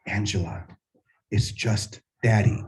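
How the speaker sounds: soft, low, unmasked dad voice; gentle reassurance. Retold or imitated. Father drops the beard for his scared daughter, soft and reassuring. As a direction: soothe a frightened child quietly, with pauses.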